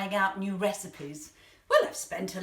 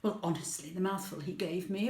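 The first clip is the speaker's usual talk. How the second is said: ordinary delivery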